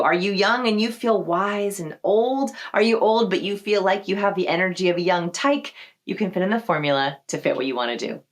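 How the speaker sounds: ordinary talk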